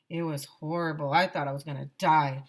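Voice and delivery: ordinary delivery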